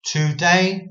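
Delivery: "today" read as TOO DAY, full oo; careful two words. Shown as a demonstration. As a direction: open the scripture line with "today" split in two. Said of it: "more careful and more correct".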